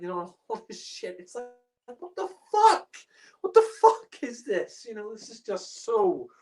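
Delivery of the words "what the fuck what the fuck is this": high, loud disbelief; can't believe what he's hearing; shocked yelp of disbelief; incredulous outburst. Real, not acted. Ranting about the awful soundtrack.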